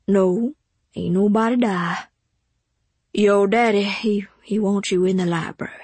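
maid with a Southern dialect, steady; maid's voice. A narrator's reading. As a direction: answer as the steady household maid.

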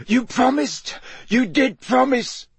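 very high, choked, desperate plea; choked pleading. A narrator's reading. Wounded servant reminds his lord of a promise, choked and high-pitched. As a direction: beg in a high, strangled wail. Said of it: "he choked".

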